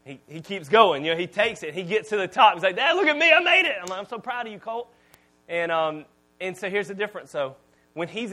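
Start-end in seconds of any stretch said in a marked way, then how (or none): 2.8-3.8 put-on high, excited child calling from the ladder top; excited kid. Son shouting down to his dad from the ladder, thrilled. 4.0-4.9 soft, tender praise; proud dad. Retold or imitated. Dad softly praising his son at the ladder top.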